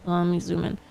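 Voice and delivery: ordinary delivery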